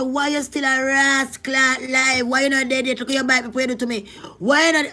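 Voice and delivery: high, loud, mocking scorn; scornful jeering. The sister taunting the dying man, loud scorn. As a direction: spit it at him with contempt.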